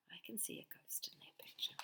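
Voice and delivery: fast whisper. Shown as a demonstration. Reader spotting the ghost with children, whisper. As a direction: whisper it like a secret. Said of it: "Can you see a ghost".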